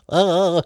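high trilled "uh" noise; SpongeBob-style trill. Shown as a demonstration. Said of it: "make your voice trill kind of thing".

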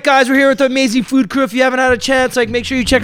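high-pitched presenter patter; social media coordinator. Retold or imitated. Faking festival media crew on camera, high presenter voice. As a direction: pitch it up like a festival host on video. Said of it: "pretended that I was the social media, like, coordinator".

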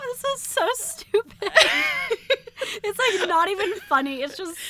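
high, laughing through words; cracking up in disbelief. Real, not acted. Laughing at own old writing.

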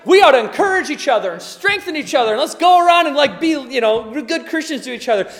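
a hollow, hammed-up cheerleader; mock cheery rallying.

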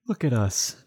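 lightly voiced, giggly lovers' banter; playful couple voice. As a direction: tease it like a smitten partner in crime. Acting out the escort and killer.

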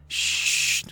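soft breathy hush; hushing friends as enemies approach; whispered shush. A narrator's reading. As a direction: silence them with a whispered shush.

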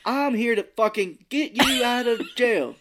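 high, loud, slow hammed-up Southern drawl with a swear; a badly dubbed Southern lawyer; bad voiceover Southern. Spoofing a censored TV dub of Keanu, mock Southern accent. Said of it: "it does sound like a bad voiceover".

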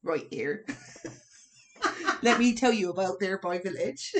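lightly voiced Cornish kobold; a hint of a Cornish creature accent. Kobold emerging from darkness to give information, light Cornish accent. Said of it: "the Cornish kobold".

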